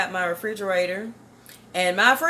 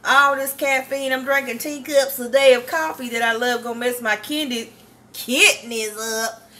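mock protest; 4 semitones higher, 5 dB louder than usual. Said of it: "I'm like".

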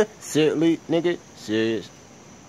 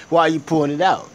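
higher, louder scolding of himself; louder challenge; 4 semitones higher, 7 dB louder than usual. Real, not acted. Scolding himself in the mirror for pulling hair, louder. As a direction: call yourself out, louder. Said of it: "talking to himself in mirror".

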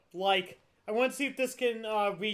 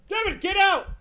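high, loud alarmed warning; urgent shout; 11 semitones higher, 8 dB louder than usual. Real, not acted. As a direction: yell for your teammate to flee. Warning a teammate to flee.

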